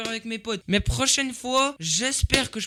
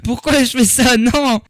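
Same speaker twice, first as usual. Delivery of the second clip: protestation criée; 3 semitones higher, 7 dB louder than usual. Real, not acted. Panique, sa mère regarde le live.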